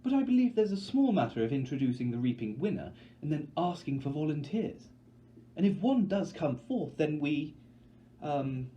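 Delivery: prim host losing her script; unsure Effie. Acted. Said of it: "she trails off, unsure herself".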